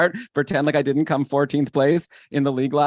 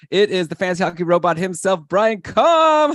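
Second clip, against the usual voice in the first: ring-announcer build to a high, loud name; excited host's big reveal; hyped introduction; 5 semitones higher, 4 dB louder than usual. Real, not acted. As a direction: announce the co-host with fanfare, stretching the name. Host announces co-host with fanfare.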